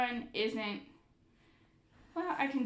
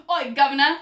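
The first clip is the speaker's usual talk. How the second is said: loud, higher hammed-up Cockney call; mock Cockney; 5 semitones higher, 15 dB louder, faster than usual. Host mimicking a typical American's Cockney, loud and hammed-up. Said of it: "go Cockney and be like".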